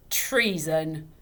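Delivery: mock the word; father jeering at the widow. A narrator's reading. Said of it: "jeered Mister Dovetail".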